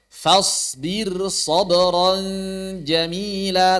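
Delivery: slow recitation of "Fasbir sabran", r in "Fasbir" thin and light. Shown as a demonstration. Teacher recites ra before sad in another word, thin r. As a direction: stretch it out, keeping "Fasbir" light on the r. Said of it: "maka dia dibaca tipis".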